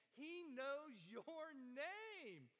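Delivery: each word drawn out slowly; slow, weighty preaching; slow emphatic. Acted. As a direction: let the name land, slow. Preacher stresses God's knowing.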